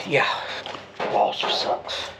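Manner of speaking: ordinary delivery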